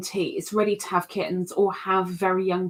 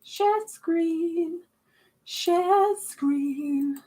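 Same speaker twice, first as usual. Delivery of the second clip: sing-song; 8 semitones higher, much slower than usual. Pretend.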